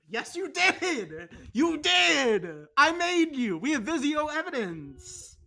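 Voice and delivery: loud, high laughing outburst; laughing shout. Real, not acted. Roommate laughing and yelling protest at roommate Danny. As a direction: yell it through laughter.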